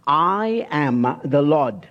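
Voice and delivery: every word weighed, slow and deliberate; solemn slow emphasis; slow emphatic. Shown as a demonstration. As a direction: lay each word down slowly. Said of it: "here's the phrase".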